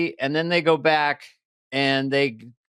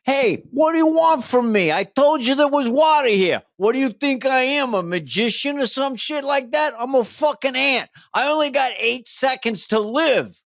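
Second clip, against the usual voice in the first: Brooklyn ant; 10 semitones higher than usual. Retold or imitated. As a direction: complain like a high Brooklyn wise guy. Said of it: "dumb ants have a Brooklyn accent".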